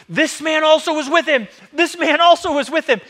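high, loud accusing shout. Acted. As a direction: shout it out to expose him in front of everyone. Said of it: "and starts shouting".

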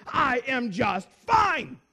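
slow, emphatic mock indignation; curt offended retort. Seatmate offended by a Bible question, curtly indignant. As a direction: imitate a man shutting the conversation down. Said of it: "his whole demeanor changed".

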